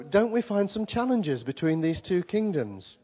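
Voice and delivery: ordinary talk, nothing marked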